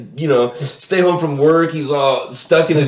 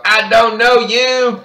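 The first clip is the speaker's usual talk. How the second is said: high, drawn-out mock yell; retold Bobby yell; 9 semitones higher, 3 dB louder, slower than usual. Bobby warning off the bully's friends, high mock yell. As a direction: stretch it into a cartoon self-defense cry. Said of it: "he yells out something he learned".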